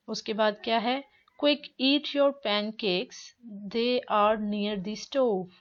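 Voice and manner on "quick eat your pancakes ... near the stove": very slow, clear English; slow read-aloud. Shown as a demonstration. Teacher reading mom's line to students, very slow. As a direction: read it slowly for the class. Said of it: "teacher reads dialogue line slowly".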